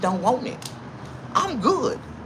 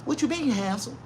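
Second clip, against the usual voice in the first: 1 mock flirty woman; 8 semitones higher, 6 dB quieter than usual. Woman flirts in retold scene.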